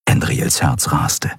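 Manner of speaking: plain reading voice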